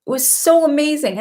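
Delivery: barely holding back tears; tearful. Real, not acted. Telling viewers about the scented towel, tearful. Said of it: "I'm crying more".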